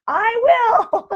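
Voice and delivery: high, laughing promise. Retold or imitated. Answering the store lady, high laughing promise. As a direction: laugh it through. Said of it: "And I'm like".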